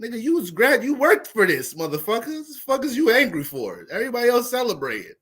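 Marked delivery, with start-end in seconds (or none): none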